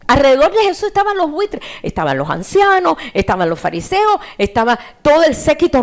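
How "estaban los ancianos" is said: normal talking voice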